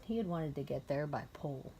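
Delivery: ordinary delivery, nothing marked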